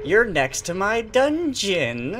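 high, gleeful creep voice; creepy killer. Pretend. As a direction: toy with your victim in a squeaky, sinister pitch. Playing creepy killer dragging victim.

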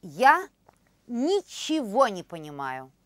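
ordinary delivery

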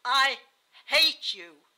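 slow, loud venom; hateful outburst. A narrator's reading. Dying wife declaring hatred to her husband, hateful. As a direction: spit each word out, long and loud.

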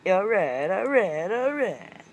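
loud, high, building chant; hyped shout. Real, not acted. Hyped exclamation on camera.

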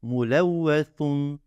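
"Mulevvesun" slowly, with the final s as a lisped th; "Mulevvesun" with a th sound for s, drawn out. Shown as a demonstration. Teacher slowly sounding out "Mulevvesun" with a lisp. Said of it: "onu da peltek okuyacağım".

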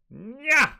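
high, loud nasty "nya"; attacking cat cry. Acted. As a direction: screech it as the cat pounces. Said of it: "The cat goes".

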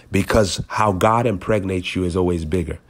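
ordinary talk

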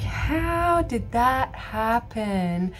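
slow, puzzled disbelief; drawn-out wondering question; amazed doctor. Retold or imitated. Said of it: "the doctor's gonna be like".

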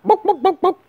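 high squawky bawk bawk; chicken clucking. Retold or imitated. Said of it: "You're chicken".

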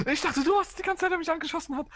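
high and loud with disbelief; excited. Real, not acted. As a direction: blurt it out high and surprised.

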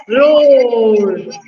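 put-on big lion roar for children. Shown as a demonstration. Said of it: "what is the sound of lion".

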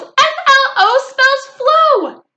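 very high, slow, loud spelling; thrilled squeal, letter by letter; real thrilled. A narrator's reading. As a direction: shout each letter with childish glee. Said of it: "I spelled real thrilled".

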